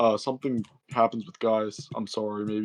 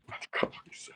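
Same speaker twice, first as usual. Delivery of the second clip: whispered aside; 12 dB quieter, much faster than usual. Real, not acted.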